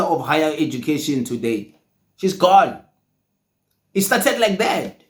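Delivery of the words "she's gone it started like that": usual speaking voice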